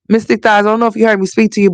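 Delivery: normal talking voice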